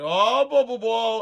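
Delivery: mock Italian gibberish. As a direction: babble like a fake Italian lizard. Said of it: "It sounded like gibberish".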